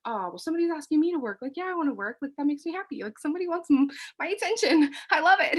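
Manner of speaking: excited. Retold or imitated. Retold happy reaction to invitation.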